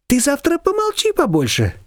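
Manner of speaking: high, lively neighbour voice; Shushana's voice. A narrator's reading. Neighbour brushing off Nina, high and bossy.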